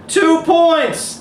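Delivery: high loud joyous shout; triumphant cry. A narrator's reading. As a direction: cheer the basket. Winning shot climax.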